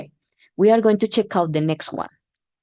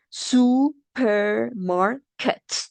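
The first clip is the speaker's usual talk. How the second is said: supermarket said slowly and higher, syllables apart; slow syllable drill; 5 semitones higher, much slower than usual. Shown as a demonstration. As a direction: spell out supermarket by ear. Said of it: "next word".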